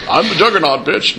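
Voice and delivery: tough arcade brute line; Juggernaut voice. Shown as a demonstration. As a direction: growl it like a game bruiser. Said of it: "here's all my guys".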